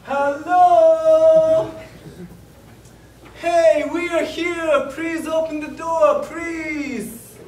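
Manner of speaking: loud, high, drawn-out calling out; shouting. Retold or imitated. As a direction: shout to be let in at a closed door. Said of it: "so we shouted like".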